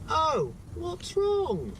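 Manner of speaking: high, slow, sing-song concern; cheery shop worker. Retold or imitated. Cashier asking a grumpy customer, high sing-song. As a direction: mimic a chirpy cashier asking. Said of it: "he was like".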